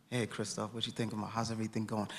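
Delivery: soft, hushed aside to a friend; quiet chat. Retold or imitated. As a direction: murmur it close, just between you two. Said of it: "these quiet little conversations".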